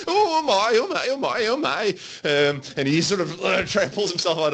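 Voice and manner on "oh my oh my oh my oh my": much higher, fluttering "oh my"; flustered servant squeal. Acted. Servant losing his pants in a hug, squealing much higher in shock. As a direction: squeak it out like a mortified servant whose pants just dropped. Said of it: "you hear them like".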